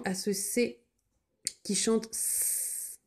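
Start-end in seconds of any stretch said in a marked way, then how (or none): 1.6-3.0 qui chante S whispered, slow and hissing. Shown as a demonstration. Teacher whispering the S sound to pupils. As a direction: breathe it out slowly on the S. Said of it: "whispered hissing S".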